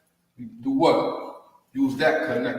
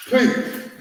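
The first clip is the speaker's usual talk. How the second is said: high breathy peace; 10 semitones higher than usual. Shown as a demonstration. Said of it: "you use it as peace".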